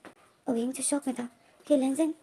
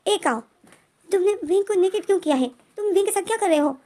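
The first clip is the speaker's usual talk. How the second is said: retold angry call raised high and loud; angry demand; 7 semitones higher, 4 dB louder than usual. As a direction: demand it loudly in the prince's voice. Lenjen confronts Kao over Wing. (युवराज काओ को ऊँची आवाज़ में डाँटता है)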